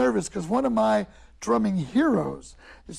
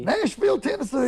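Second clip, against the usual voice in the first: put-on Nashville accent; 4 semitones higher than usual.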